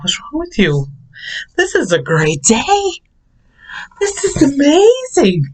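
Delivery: high, sing-song cheerful voice, slowing on amazing; chirpy mock cheer. Manager mocking upset workers with high chirpy cheer. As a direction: gush over it like it's the best day. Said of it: "I'm like".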